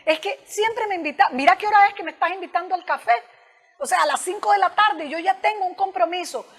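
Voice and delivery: reproche acusador, más agudo y más fuerte; blaming complaint. Shown as a demonstration. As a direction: como quien culpa al otro de todo. (queja acusadora, aguda y fuerte)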